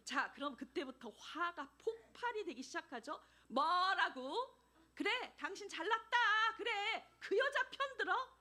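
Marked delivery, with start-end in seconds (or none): 3.5-8.4 높고 크게 쏘아붙이는 화난 아내; angry shout. Retold or imitated. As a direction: shout at the husband in fury. Angry wife shouting at husband. (남편에게 소리치는 화난 아내)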